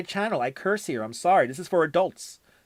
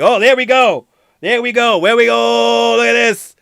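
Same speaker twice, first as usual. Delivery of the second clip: excited; 3 semitones higher, 6 dB louder than usual. Real, not acted. Chat votes coming in.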